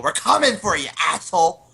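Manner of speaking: high, loud battle threat; in-character shouted taunt; acted yell. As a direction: yell it as you burst in. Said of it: "I yell".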